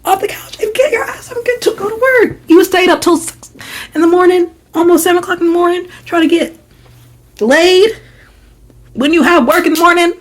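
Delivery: very high, indignant rant; high-pitched fed-up tirade; exasperated scolding. Retold or imitated. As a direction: scold them in a high, incredulous squeal. Imagined scolding of roommate.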